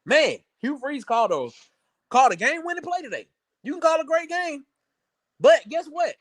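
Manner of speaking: high-pitched mock praise of the coach; sarcastic. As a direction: play at giving credit, pitched well up, sarcastically. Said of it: "that was me being sarcastic".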